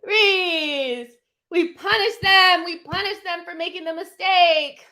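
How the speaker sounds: high, loud celebrating yell at the team; excited yell. Real, not acted. Fan yelling at the screen after a turnover, excited. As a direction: scream it out as your side wins the ball back. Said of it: "I scream and yell".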